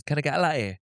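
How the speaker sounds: put-on strong Geordie accent with the T's dropped. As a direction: order quickly in thick Geordie. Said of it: "she's got a really strong Geordie accent".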